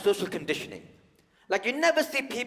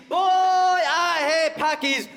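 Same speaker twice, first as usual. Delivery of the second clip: put-on "Boy, I hate" bellowed higher and slower; louder mock shout; 13 semitones higher, 9 dB louder, much slower than usual. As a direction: push "hate" even harder. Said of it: "be like".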